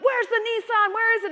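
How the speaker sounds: loud, high demanding yell; angry customer screaming. Retold or imitated. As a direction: shout the demand as the irate customer. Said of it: "still screaming about a Nissan".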